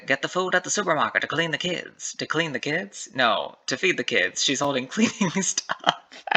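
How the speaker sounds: put-on lightly done transatlantic accent; a touch of old Hollywood transatlantic accent. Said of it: "That was my attempt at a transatlantic accent".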